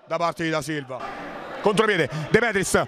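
ordinary talk

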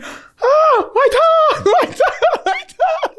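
imitating a teammate's high cry, laughing through it; high-pitched mock wail with giggles; mock pained yelp. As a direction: squeal it like a hurt friend, cracking up. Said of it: "And then she's like".

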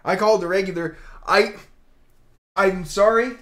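elated shouting; excited. Real, not acted. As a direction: still reeling from the pull, loud and high. Still elated after the pull.

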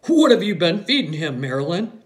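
loud, hearty father's question; lightly voiced father with a big voice. A narrator's reading. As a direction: boom it out to your wife. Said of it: "a big presence and a big voice".

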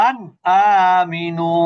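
আন আমিনু with the ন of আন pronounced clearly, drawn out slowly; clear nun recitation. Shown as a demonstration. Teacher slowly demonstrating clear nun recitation to a student. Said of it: "নুনটাকে স্পষ্ট করে পড়তো".

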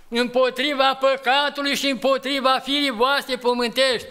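high, loud preacher's call; fiery preaching. Acted. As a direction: urge the congregation with full force. Preaching climax, raised voice. (predică aprinsă, cu voce ridicată și puternică)

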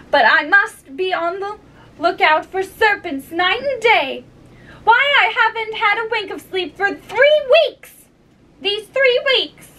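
high-pitched, exasperated bird voice; plaintive pigeon listing grievances; fretful pigeon. Acted. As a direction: complain like a harried mother bird.

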